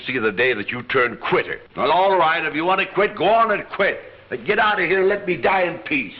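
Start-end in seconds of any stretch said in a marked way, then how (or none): 1.8-6.1 angry. Acted. Angry old man scolds Gene.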